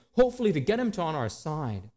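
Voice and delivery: ordinary talk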